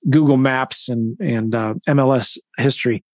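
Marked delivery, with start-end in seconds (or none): none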